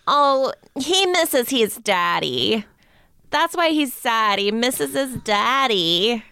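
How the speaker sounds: put-on high, slow cooing baby voice; imitating a doting dog owner's baby talk. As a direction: coo like a woman talking to her dog. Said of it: "she had one of those baby voices".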